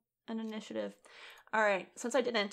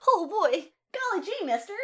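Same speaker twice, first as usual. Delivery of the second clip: put-on high, bright golly-gee kid voice; wholesome 1950s kid; 12 semitones higher, 6 dB louder than usual. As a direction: play an eager old-timey kid. Echoes with golly gee mister.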